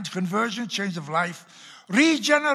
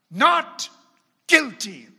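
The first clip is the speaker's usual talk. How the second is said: much louder and higher, booming out the verdict; judge's verdict; 4 semitones higher, 10 dB louder, slower than usual. Retold or imitated. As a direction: proclaim it like a judge banging the gavel. Judge declares verdict, retold.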